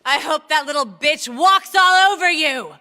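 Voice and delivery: put-on drunken snarl. Drunk man curses.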